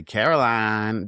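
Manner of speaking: very slow drawn-out Southern drawl; slow mock Southern drawl. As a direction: stretch the name like a lazy drawl. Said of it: "everyone walking around say".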